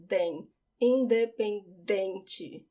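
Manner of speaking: Independente slow with stress on dem; Independente, in-de-pen-DEN-te. Shown as a demonstration. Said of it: "it's on the second to last syllable".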